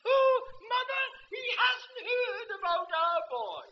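high, loud, cried out to his wife; astonished old man. Retold or imitated. Old man cries to his wife that the preacher hasn't heard, loud. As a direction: shout it in disbelief to Mother.

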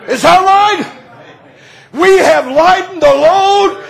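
rising to a shouting preacher's climax; preaching at full force. Acted. Preacher to congregation about Annie, preaching at full force.

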